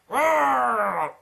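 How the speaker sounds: a put-on roar in an odd voice; playful monster roar; mock roar. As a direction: roar like a half-robot monster. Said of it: "General Grievous is like".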